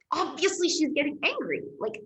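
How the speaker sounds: high, fast exasperated outburst; words tumble out in outrage; indignant rant. Real, not acted. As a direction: pitch shoots up in disbelief. Indignant rant about angry woman.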